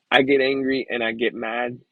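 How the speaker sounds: ordinary delivery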